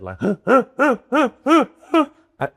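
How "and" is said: high, loud helpless laughter; can't hold it in, laughing hard; laughing fit. Real, not acted. Remembering losing it at the gig, real high loud laughter. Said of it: "properly a laughing fit".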